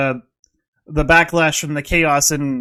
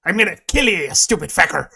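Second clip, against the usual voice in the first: menacing Irish. Retold or imitated. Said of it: "the Northern Irish are like".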